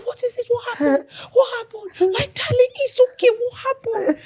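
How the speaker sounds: high, loud, fussing mother imitation; high-pitched, tearful mock concern; alarmed mother. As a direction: act out a mother urgently consoling her wailing child. Mother alarmed at her wailing daughter.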